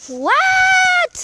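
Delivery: loud high startled what; shocked shout. Real, not acted. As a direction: yelp it out in shock. Shocked at coca bottle.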